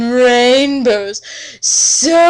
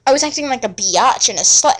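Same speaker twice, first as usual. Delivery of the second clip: usual speaking voice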